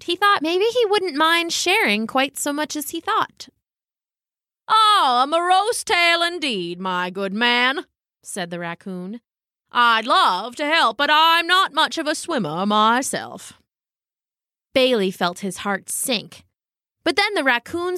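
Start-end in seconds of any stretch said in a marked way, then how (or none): 4.7-7.9 pompous raccoon. Acted. Raccoon responding to the dog's sad story, grandly sympathetic. 9.7-13.5 grand raccoon voice, lifted at the end; pompous raccoon. Acted. Raccoon excusing himself to the dog, grandly apologetic.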